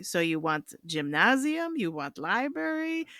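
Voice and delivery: lightly put-on Hungarian accent, broken English; a hint of Hungarian accent, offering options; Laszlo's Hungarian accent. Laszlo pitching rooms to Van Buren in a light Hungarian accent. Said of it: "that's a really disrespectful accent to have".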